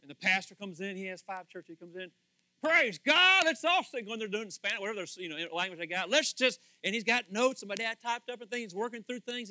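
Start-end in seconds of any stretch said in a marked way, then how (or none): 2.6-4.1 high, loud call to worship; a hut pastor rousing his flock to sing, loud and high; preacher calling out. Retold or imitated. Pastor voiced leading hut church. 6.1-6.6 preacher calling out. Retold or imitated. Pastor voiced leading hut church.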